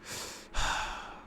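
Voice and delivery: long, soft breathy sigh; exasperated sigh. Acted. Izuku answering Ida's nagging, exasperated sigh. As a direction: let out a weary, put-upon sigh. Acted exasperated reply to Ida.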